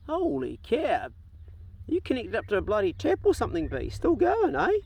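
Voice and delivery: high-pitched, incredulous marvelling; amused disbelief. Real, not acted. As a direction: marvel in a high voice at something that won't end. Amazed dog is still peeing.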